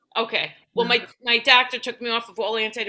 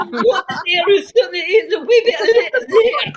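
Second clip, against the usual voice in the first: loud, higher mock Scottish gibberish; hammed-up incomprehensible Scottish boyfriend; Scottish accent; 5 semitones higher, 7 dB louder than usual. Scottish boyfriend talking about the theater, loud Scottish accent. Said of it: "dated a Scottish guy".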